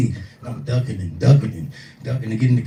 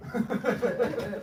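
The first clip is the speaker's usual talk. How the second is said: high laugh; 11 semitones higher, 10 dB quieter than usual. Real, not acted. Speaker laughs after joke.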